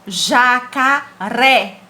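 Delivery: JACARÉ bem devagar, com o É aberto; som aberto. Shown as a demonstration. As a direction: silabar "Jacaré" e abrir bem o RÉ final. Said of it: "abre o som". ("Jacaré" pausado, último E aberto e forte)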